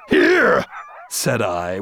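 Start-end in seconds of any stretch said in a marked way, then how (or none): 0.1-0.7 a sharp high cry; startled high shout; sudden cry. A narrator's reading. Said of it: "with a sudden cry".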